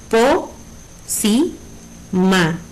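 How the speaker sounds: PO, SI, MA, each on its own beat; teacher separating Po, si, ma one by one; syllable by syllable. Shown as a demonstration. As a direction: split pócima with pauses, Po, si, ma.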